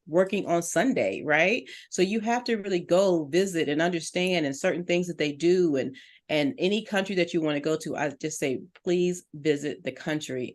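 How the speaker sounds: ordinary talk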